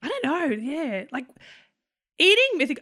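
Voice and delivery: ordinary talk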